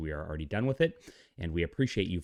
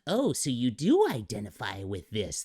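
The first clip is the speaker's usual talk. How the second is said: mock smug retort; 4 semitones higher, 3 dB louder than usual. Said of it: "they go".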